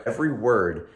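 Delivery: ordinary delivery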